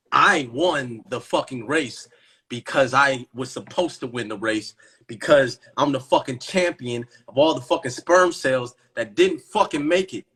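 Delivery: usual speaking voice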